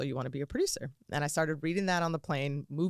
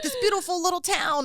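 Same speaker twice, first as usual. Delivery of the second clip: mock sales pitch; 13 semitones higher, 5 dB louder than usual. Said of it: "they're like".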